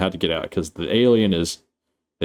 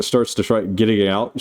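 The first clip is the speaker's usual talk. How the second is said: normal talking voice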